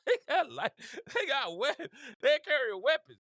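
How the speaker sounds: high, laughing while repeating the point. Real, not acted. Joking to the crowd about armed arrivals, laughing. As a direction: push the words out through laughter.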